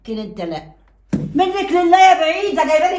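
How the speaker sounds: a mother ready to break his neck; furious mother. Acted. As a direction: storm at him to defend your daughter. Mother storms in at son-in-law.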